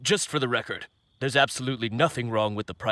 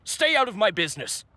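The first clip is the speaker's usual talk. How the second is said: nervous seller blurting out a defensive outburst; 9 semitones higher than usual. Acted. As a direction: push them away, rattled and guilty. Fishmonger gets defensive.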